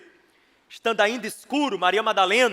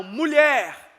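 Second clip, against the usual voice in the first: raised, drawn call; a preacher voicing a call; 5 semitones higher, 7 dB louder than usual. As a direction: call out to her. Voices angels calling to Mary.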